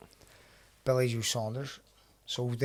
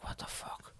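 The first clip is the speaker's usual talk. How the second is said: whispered retold disbelief; stunned whisper; 13 dB quieter than usual. Retelling stunned reaction to brawl.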